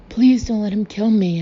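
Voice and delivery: loud, high, sniveling plea; sniveling terror. A narrator's reading. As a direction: repeat it like a mantra of fear. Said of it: "in a sniveling mantra of terror".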